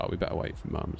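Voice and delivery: soft unvoiced whisper. Dad retelling the walk, whispering to his son. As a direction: breathe it quietly, almost soundless. Quiet aside retold.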